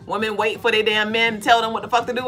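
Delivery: ordinary talk, nothing marked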